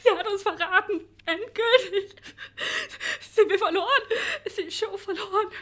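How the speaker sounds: choked, half-laughing sobs; tearful. Acted. Distraught Charlotte breaking down to the producer, tearful. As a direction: cry it out, barely holding on.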